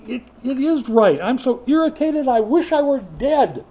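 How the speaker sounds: high loud sulky grumble; irritated whine. Acted. Jonah telling God he is irritated, loud whine. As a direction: sulk out loud that you wish you were dead. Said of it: "he said".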